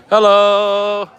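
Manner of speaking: high hello. Real, not acted. Greeting someone loudly.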